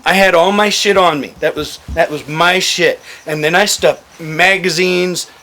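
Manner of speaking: usual speaking voice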